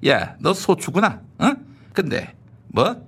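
ordinary delivery

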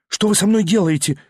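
ordinary delivery

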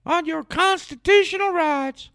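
slow, very high mocking falsetto; drawn-out squeaky mockery; Ron Paul. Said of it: "you even hear people like Ron Paul".

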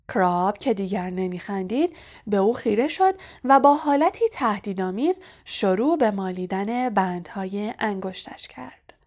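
usual speaking voice

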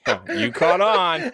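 chuckling as he admits it; slow amused laugh; laughing, busted. Real, not acted. Caught out by the joke.

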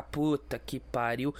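low, soft, slow groan of disbelief; exasperated sigh. Retold or imitated. Cop fed up with the clueless biker, low muttered groan. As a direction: let it out like a tired cop.

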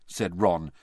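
ordinary delivery, nothing marked

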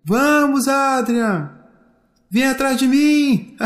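high, long-held call ending in laughter; merry far-carrying call; playful calling. A narrator's reading. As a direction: urge the child to chase you, then laugh. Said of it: "uma voz seguida de um belo riso".

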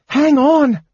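high, loud urging; panted urgent cry. A narrator's reading. Kender urging on failing mage, high panted cry. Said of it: "Tasselhoff panted".

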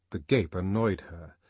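plain reading voice

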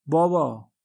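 a loud "بابا" that falls at the end; calling out. A narrator's reading. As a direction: lift the voice to summon him. Calling father across the room.

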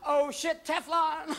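high-pitched cat in mock alarm; gasp, then squeal it high; cartoon cat losing its grip; panicked cat. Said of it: "too, hit the wall and went".

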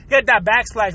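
ordinary talk, nothing marked

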